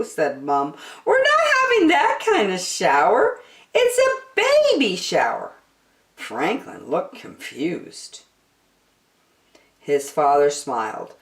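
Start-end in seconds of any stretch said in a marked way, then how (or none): 1.1-5.5 high, gently explaining voice; amused mother. Acted. Mom explaining the baby shower, high and gentle. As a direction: correct him kindly.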